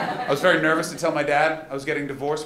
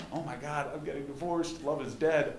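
soft, hushed inner lament; quiet despair; 8 dB quieter than usual. Retold or imitated. Comic recalling despairing thoughts, much softer. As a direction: mope it under your breath.

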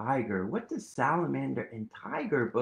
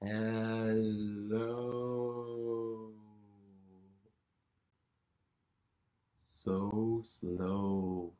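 lower, drawn-out slow hello; 3 semitones lower, 4 dB quieter, much slower than usual. Shown as a demonstration. Teacher modelling for kids, slow sleepy hello. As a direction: stretch it out like a sleepy sloth. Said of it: "say hello as slow as we can".

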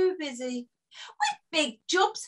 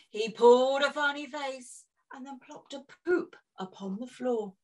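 usual speaking voice

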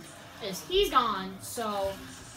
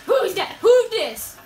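surprised shout; 7 semitones higher, 13 dB louder than usual. Real, not acted. Surprised callout in game.

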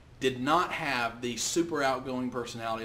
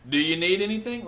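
loud, higher hard-sell greeting; pushy car salesman; 3 semitones higher, 7 dB louder than usual. Retold or imitated. Car lot salesman pouncing on a browsing customer, loud and pushy. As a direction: push the sale on the customer at once. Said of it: "what do you do when you go in a car lot".

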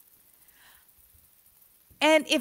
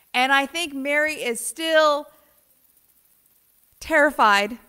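ordinary delivery

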